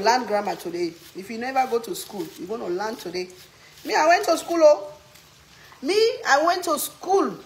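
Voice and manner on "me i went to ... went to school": high, loud insistence; proud loud claim. Real, not acted. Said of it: "loud proud claim".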